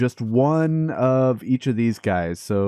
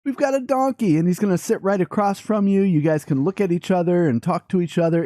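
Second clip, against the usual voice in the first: higher, sing-song pet talk; cooing to a pet; 6 semitones higher than usual. Real, not acted. Owner introducing Carl to his new donkey friend, high cooing. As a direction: coo at him like a happy owner.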